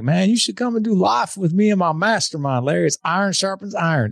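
high, exuberant imitation of a Nashville mentor; Nashville drawl, full of energy. Mentor invites friend into his mastermind, high Nashville impression. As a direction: pitch it way up, hearty Nashville invitation. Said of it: "You channel Nashville really well".

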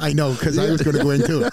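amused chuckle in the voice; laughing. Real, not acted. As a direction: laugh while admitting it. Host laughs at recruiting lie.

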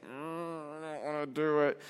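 soft, low grudging whine; reluctant kid. Retold or imitated. Student to teacher, soft grudging. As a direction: drag it out unwillingly.

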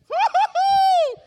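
very loud, high celebratory woo-hoo; excited whoop. Retold or imitated. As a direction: whoop it out long and high, elated. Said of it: "I was so excited".

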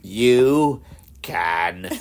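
slowly drawn out, icy "You can leave."; slow cold dismissal. Retold or imitated. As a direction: deliver it coldly, dragging each word. Quoting Meredith on TV.

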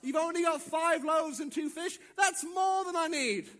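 loud, high, emphatic promise; rousing assurance. Acted. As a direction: proclaim it to a doubter at full force. Jesus urges with full conviction.

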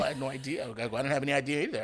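mimicking an indignant comeback; mock astonishment. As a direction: act out playful disbelief, building in volume. Mock-protesting back at the superintendent.

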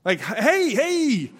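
high, loud whoa, pulling back; playful alarmed voice; startled Jesus. Retold or imitated. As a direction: fend off a bear hug, laughing. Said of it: "he's like".

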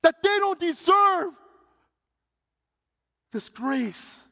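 high, loud, outraged shouting; screaming reporter. Retold or imitated. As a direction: scream it at them in disbelief. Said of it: "he started screaming, yelling".

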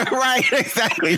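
laughing agreement, pitched high; high, laughing right, exactly. Real, not acted. Laughing at the joke.

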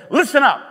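LISTEN UP punched high and loud; very emphatic. Retold or imitated. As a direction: call out "Listen up" high and loud, demanding attention. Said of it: "This is very emphatic".

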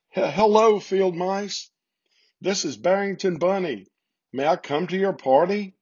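high, tearful blurt; a little bunny calling out through tears. A narrator's reading. Bunny blurting to the field mice through tears, high and tearful. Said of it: "he blurted out through his tears".

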